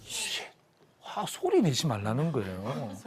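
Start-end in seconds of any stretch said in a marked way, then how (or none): 0.0-0.5 short "shh" for silence; hushing shush. Acted. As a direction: shush the room. 하나님의 조용하라는 신호 흉내. (조용히 하라는 쉿 소리)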